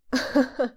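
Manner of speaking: short laugh he can't hold in; burst of laughter. Acted. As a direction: laugh despite himself. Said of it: "Не сдержался я".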